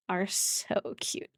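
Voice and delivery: slow breathless hush; soft adoring coo; hushed cooing over the babies. Real, not acted.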